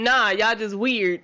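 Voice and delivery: ordinary talk, nothing marked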